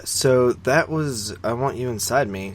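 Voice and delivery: usual speaking voice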